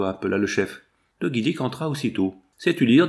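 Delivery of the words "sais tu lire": louder, higher challenge; gruff question. Acted. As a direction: fire the question at him. Chief questions his man.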